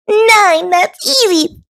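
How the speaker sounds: high eager shout. Acted. Thistle showing off her sums to Goose, shouted. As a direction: call it out, showing off. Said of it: "Thistle shouted".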